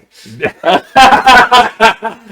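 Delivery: big loud burst of laughter. Real, not acted. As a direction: crack up loudly.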